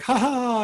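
high hammed-up action-hero laugh; imitating a triumphant movie laugh; mock heroic laugh. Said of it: "flying off of a building like".

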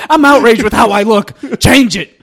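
hammed-up outrage; mock outrage. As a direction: whine like an offended poster. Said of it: "mock complainers".

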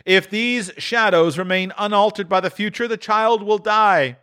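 the ghost. A narrator's reading. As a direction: foretell the empty seat gravely. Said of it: "replied the ghost".

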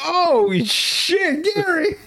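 very high, panicked mock cry; mock horror. Bystander watches best friend get kicked, high mock horror.

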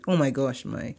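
ordinary delivery

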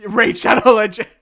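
shouted ad name; loud. Pretend. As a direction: yell it out.

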